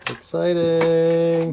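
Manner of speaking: slow, drawn-out exciting; excited. Real, not acted. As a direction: stretch the word out, thrilled. Announcing excitement.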